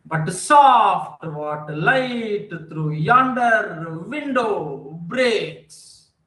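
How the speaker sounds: very slow, each beat spaced out; Shakespeare line drawn out slowly, da-DUM by da-DUM; slow iambic recital. Shown as a demonstration. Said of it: "You know".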